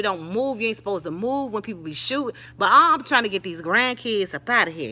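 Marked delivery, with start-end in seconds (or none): none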